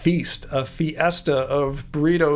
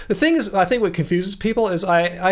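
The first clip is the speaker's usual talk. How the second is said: ordinary delivery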